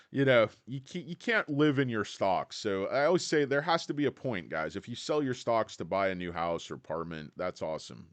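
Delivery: usual speaking voice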